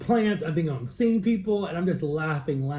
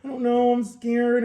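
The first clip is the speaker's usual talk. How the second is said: put-on higher whiny I'm scared, a big baby; mock scared baby; 8 semitones higher, 5 dB louder, slower than usual. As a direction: play it like a frightened big baby. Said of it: "being a big baby".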